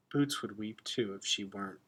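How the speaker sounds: ordinary delivery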